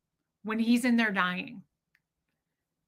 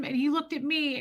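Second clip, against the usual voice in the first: usual speaking voice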